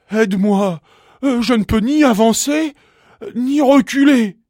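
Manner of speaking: high-pitched, loud, distressed begging; desperate pleading. A narrator's reading. Stuck bumblebee begging the bee for help, desperate. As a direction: plead loudly, voice pushed right up high. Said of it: "supplia le bourdon".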